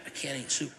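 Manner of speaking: quiet pouting "I can't eat soup"; sulky mutter. Retold or imitated. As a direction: grumble "I can't eat soup" under the breath. Quoting his sulky reply.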